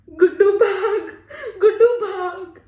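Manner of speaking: loud, high, tearful urging to run; desperate shout. Acted. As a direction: shout for your brother to escape. Urging brother to flee captors. (रोते हुए ज़ोर से चिल्लाना)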